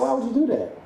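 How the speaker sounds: high, incredulous cry; can't believe what he's hearing; outraged disbelief. Retold or imitated. Man reacting to her apology, high and incredulous. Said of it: "I'm like".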